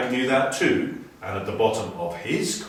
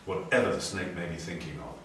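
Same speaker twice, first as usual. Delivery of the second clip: usual speaking voice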